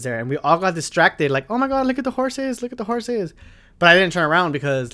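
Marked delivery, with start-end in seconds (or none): 1.5-3.3 high, excited squeal of the group; excited about horses. Retold or imitated. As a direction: gush over the horses like a starstruck friend. Friends excited about wild horses.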